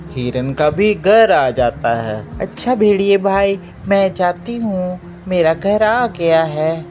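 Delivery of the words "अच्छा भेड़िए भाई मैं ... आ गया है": lightly voiced deer, pitched up; a slightly higher voice for the deer saying goodbye; hint of a high-voiced deer; deer mother, friendly goodbye.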